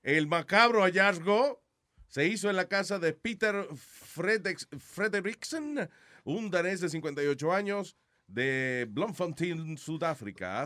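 mock news anchor, slow and weighty; dramatic news read. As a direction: read it like grim breaking news. Reads out a news story.

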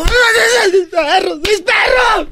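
high, slow, sobbing cry of mis perros; mock crying yell. Host imitating the traveler clapping and crying for his dogs. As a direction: wail like he's clapping in despair. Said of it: "él aplaudía así como".

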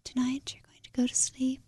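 hushed, airy bedtime-story whisper; mock ASMR whisper. Co-host mimicking the whisperer on the Calm app, mock soothing whisper. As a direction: imitate a sleep-app whisperer. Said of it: "the whisperer".

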